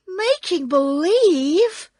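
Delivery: high, loud disbelief; crying out. A narrator's reading. Dorothy shocked by the confession, crying out. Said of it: "cried Dorothy".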